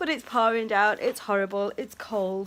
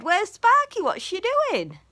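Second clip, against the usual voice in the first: "Where's Sparky?" pitched way up and much louder, cooing; high loud sing-song; 10 semitones higher, 8 dB louder than usual. Real, not acted. Owner repeating the question to her birds, high and loud. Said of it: "That's what I said".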